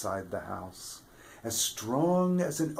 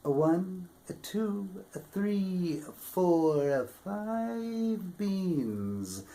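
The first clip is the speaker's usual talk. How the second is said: high, drawn-out counting; counting stranger; 9 semitones higher, slower than usual. A narrator's reading. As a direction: count the beans out slowly, up high. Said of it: "the stranger counted out".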